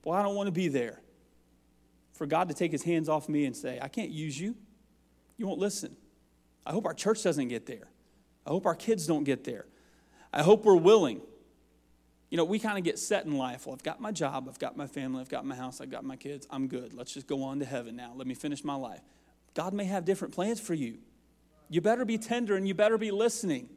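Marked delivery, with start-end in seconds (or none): 3.8-6.0 a soft, final rejection; quiet, grave God voice. Acted. God turning away from the unwilling, softly. 13.7-19.0 a softer, lightly voiced settled believer; complacent churchgoer. A settled believer brushing off God's call, softly.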